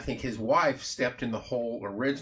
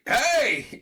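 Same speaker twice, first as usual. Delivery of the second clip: sharp high yell to get someone's attention; retold shout; 14 semitones higher, 6 dB louder than usual. Filming the bridge, yelling at a stranger blocking the shot, a loud high shout. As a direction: call out loudly, much higher than usual, to stop someone in the way. Calling out to man blocking camera.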